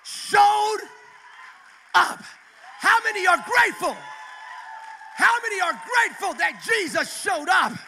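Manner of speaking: loud, high, building to JESUS SHOWED UP; full revival shout; preaching at full force. Real, not acted. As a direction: preach it to the rafters, word by word. Climactic altar-call preaching.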